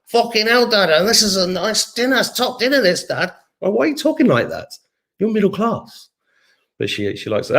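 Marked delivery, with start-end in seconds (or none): 0.1-3.3 high-pitched imitation of a Mancunian daughter; Mancunian accent. Dad imitating his daughter at dinner, Mancunian accent. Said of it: "like, a bit of a Mancunian accent". 3.6-6.1 high-pitched retold objection; mock protest. Dad retelling his reply to daughter's accent, mock protest.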